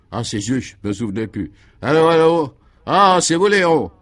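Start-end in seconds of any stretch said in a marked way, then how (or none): 1.8-3.9 high, loud telephone greeting; answering the phone. A narrator's reading. Guy picking up Léon's long-awaited call, exclaiming. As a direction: call eagerly down the line.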